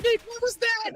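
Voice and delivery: high, stunned cry of disbelief; can't believe what just happened. Real, not acted. Stunned by ninja defuse.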